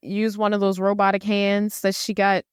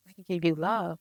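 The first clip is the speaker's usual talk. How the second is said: soft creepy robot; 3 semitones lower, 7 dB quieter than usual. Retold or imitated. Creepy robot offering love.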